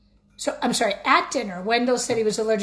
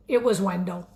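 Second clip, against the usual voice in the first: ordinary delivery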